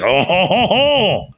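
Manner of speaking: hammed-up Gallic laugh, climbing high; mock French laugh. Joking that his partner should drop a French laugh on air. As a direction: toss in a cartoon French chuckle. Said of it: "at some point you're like".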